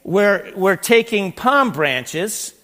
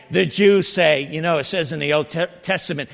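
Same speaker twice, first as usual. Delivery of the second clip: usual speaking voice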